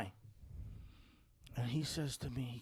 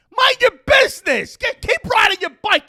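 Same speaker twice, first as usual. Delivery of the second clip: high, loud telling-off; pissed-off retold shout; 13 semitones higher, 6 dB louder than usual. Tree owner tells cyclist to move along, pissed-off shout. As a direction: send him packing at full volume. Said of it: "it pissed me off".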